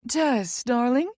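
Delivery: smooth, questioning greeting with a lift at the end; smooth alto. A narrator's reading. Friend greeting Tess on the phone, smooth alto. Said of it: "comes B's smooth alto voice".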